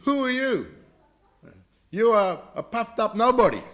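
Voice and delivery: high, loud, scornful retort; acted anger. Angry princess answering the Brahmana's daughter, scornful high outburst. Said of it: "got very angry".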